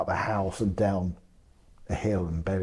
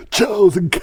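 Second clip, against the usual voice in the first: laughing; 12 semitones higher, 12 dB louder than usual. Real, not acted. Laughing while reading entry.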